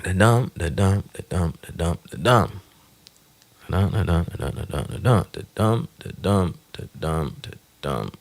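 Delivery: chanting da-dum in iambs; iambic beat. Shown as a demonstration. To show the iambic beat, a chanted da-DUM. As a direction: tap out the da-DUM rhythm. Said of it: "think of what iambic pentameter is like".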